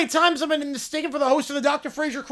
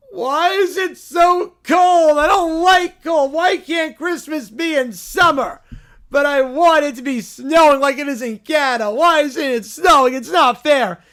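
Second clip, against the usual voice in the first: put-on whiny kid; 2 semitones higher, 6 dB louder than usual. Spoiled kid arriving for Christmas at the grandparents', loud whining. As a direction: wail the complaints like a spoiled kid. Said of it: "the Whiny Quintet".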